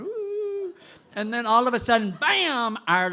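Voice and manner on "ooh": high, drawn-out ooh as the pill kicks in; a floaty, amazed ooh; dreamy ooh. Retold or imitated. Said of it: "he and I are going".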